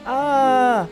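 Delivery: loud, high frustrated "ah"; long loud wail of exasperation; exasperated cry. A narrator's reading. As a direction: cry out, cornered. Cornered in the bargain.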